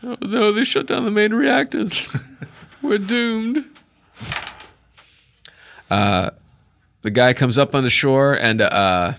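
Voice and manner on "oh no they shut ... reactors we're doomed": high-pitched hammed-up doom; squeaky-high fake distress; mock panic. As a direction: wail it in comic alarm, very high. Jokes about reactors shutting down.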